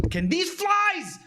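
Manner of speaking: high, drawn-out exasperated outburst; mock rage in another streamer's style; channeling Samcat. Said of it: "I'm just channeling Sam".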